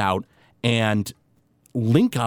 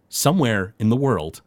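ordinary delivery

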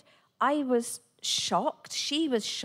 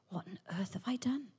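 whispered panic; 7 dB quieter than usual. Retold or imitated. Retold panicked inner thought.

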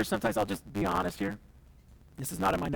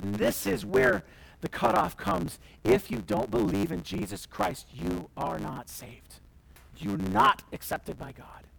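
ordinary talk

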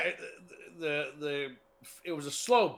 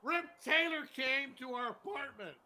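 mildly exasperated, raised pitch; angry guy; 5 semitones higher, 5 dB quieter than usual. Acted. Angry guy resuming his interrupted story, mild exasperation. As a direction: strain to get the story out. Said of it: "you're the angry guy who didn't make the".

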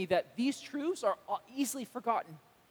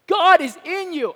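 GOD punched, much louder and higher; emphatic declaration; 9 semitones higher, 12 dB louder than usual. Real, not acted. Preacher stunning the church: loud emphatic God. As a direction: land God like a revelation. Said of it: "that's insane".

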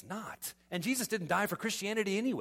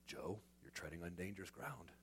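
low, soft grave warning; ominous warning; 11 semitones lower, 18 dB quieter than usual. Retold or imitated. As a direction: warn him in a hushed dark tone.

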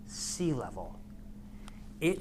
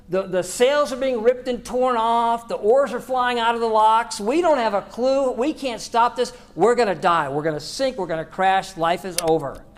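louder, higher, mock-frantic list of disasters; panicked disciple; 8 semitones higher, 11 dB louder than usual. A lightly voiced disciple panicking to Jesus in the storm. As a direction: rattle off the dangers like you're about to drown. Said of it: "maybe Peter or John or somebody said".

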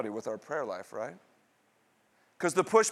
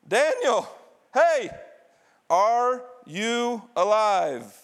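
high, loud call to someone far off; acted shout; 5 semitones higher, 5 dB louder, much slower than usual. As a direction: call out high, stretching the last words long. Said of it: "and he shouts".

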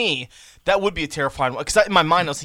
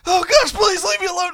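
high, pleading, frightened voice; scared pleading; 8 dB louder than usual. Retold or imitated. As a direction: beg the cat to leave. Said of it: "he was like".

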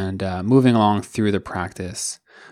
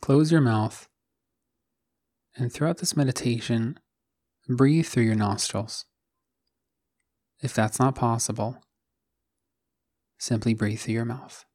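calm, even meditation voice with long pauses; guided meditation. Acted. Host guiding listeners to settle into the body, calm guided meditation. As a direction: guide them gently into stillness, one phrase at a time.

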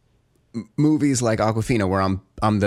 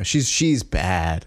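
ordinary delivery

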